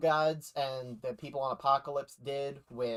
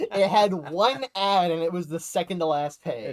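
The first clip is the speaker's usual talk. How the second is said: annoyed repeat; 4 semitones higher, 6 dB louder than usual. Shown as a demonstration. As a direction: repeat it, exasperated and emphatic. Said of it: "here's what made me mad".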